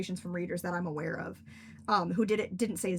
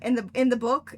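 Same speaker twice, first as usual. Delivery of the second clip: ordinary talk, nothing marked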